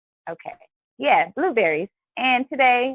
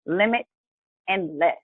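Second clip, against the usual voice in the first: "limit" and "less" set apart by a pause; two separate words; 2 semitones lower than usual. Shown as a demonstration. Host explaining the thought of the day, two separate words. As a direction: lift "limit", pause, let "less" fall. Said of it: "two words".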